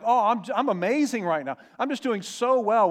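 high, loud, hammed-up cheer; pretending to be amazing. Preacher mocks putting on strength while grieving, falsely cheerful. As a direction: overplay how great everything is. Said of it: "to pretend like".

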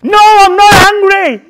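high, loud, drawn-out protest; loud, indignant denial in acted anger; angry shouted denial. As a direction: shout it out while insisting you aren't angry. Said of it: "get angry and shout and pretend you're not angry".

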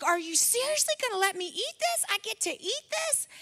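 put-on high, incredulous little-boy voice; kid tripping out. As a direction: imitate a child who can't believe his luck. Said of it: "tripping out".

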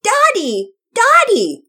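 put-on very high, loud baby squeal; a toddler thrilled by a doggy; happy toddler shout. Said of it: "shouted happily from the crib".

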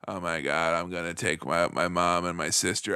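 put-on socialite voice, very low monotone. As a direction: drone it low in an affected celebrity voice. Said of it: "[name] will be like".

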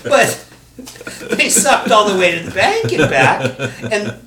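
high, loud laughing agreement; cracking up over the band. Real, not acted. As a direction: laugh through the put-down.

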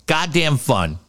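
ordinary talk

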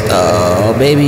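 usual speaking voice

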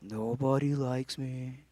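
slow, low, soft gloomy drone; Eeyore. Acted. Preacher mimicking low-spot self-pity, glum Eeyore voice. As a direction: mope in a drawn-out hushed voice. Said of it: "Remember Eeyore from Winnie the Pooh?".

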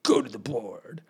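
imitating a dreaded old priest; stern old priest. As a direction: summon a pupil like a feared teacher. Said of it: "everyone's petrified".

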